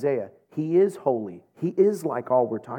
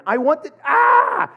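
higher and louder, breaking into a cry; mock frustrated outburst; 12 semitones higher, 7 dB louder than usual. Drive-through girl losing patience, mock frustrated yelp. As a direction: lose it halfway through the sentence.

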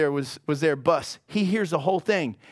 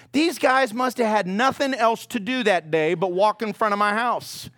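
usual speaking voice

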